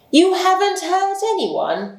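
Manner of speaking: high, slow, disbelieving outrage; angry repeat. Acted. Detective repeating the prisoner's excuse in angry disbelief. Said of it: "repeated Lestrade angrily".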